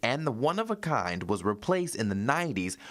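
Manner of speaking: steady, polished newscaster delivery; news read. Acted. Anchor introducing a local musician's story, news read.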